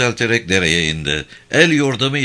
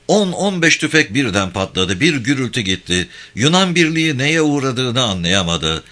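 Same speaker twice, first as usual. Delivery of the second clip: plain reading voice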